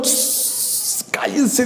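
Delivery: soft hiss then hushed order; urgent hushed command; hushing. Acted. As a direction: shush the crowd quietly. Peter hushing them with hand.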